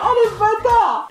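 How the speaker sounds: very high-pitched offended cry; outraged high exclamation; indignant outburst. Real, not acted. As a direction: squeal at the insult. Insulted by the quiz result.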